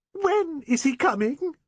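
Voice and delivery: an overwrought spinster near hysteria; gushing Miss Prentice. Acted. Miss Prentice eagerly asks.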